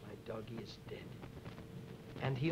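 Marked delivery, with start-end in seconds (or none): 0.1-1.0 "My doggy is dead" whispered to oneself, breathy and much softer. Shown as a demonstration. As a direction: breathe the words under your breath. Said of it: "I said, to myself".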